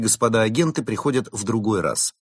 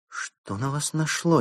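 high, slow reproachful question; drawn-out, disgruntled demand; displeased; 8 semitones higher than usual. A narrator's reading. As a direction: scold in a displeased higher voice. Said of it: "недовольно спросила лисица".

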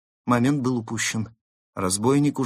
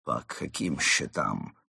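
low, soft, slow question; hushed, slow puzzlement; bewildered; 7 semitones lower, 5 dB quieter, slower than usual. A narrator's reading. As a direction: ask it meekly, not understanding. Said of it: "не понял Крот".

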